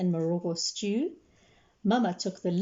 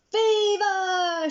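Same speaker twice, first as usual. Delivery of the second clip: high, loud cheer; cheering shout; 11 semitones higher, 5 dB louder than usual. Acted. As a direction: cheer the catch. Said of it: "shouted the ladies".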